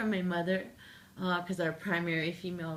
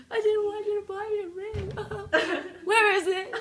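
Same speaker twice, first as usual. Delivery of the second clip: high, louder, laughing through tears; crying laugh; 13 semitones higher, 6 dB louder than usual. Real, not acted. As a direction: let the tears and laughter mix. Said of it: "I just lost my mind, crying".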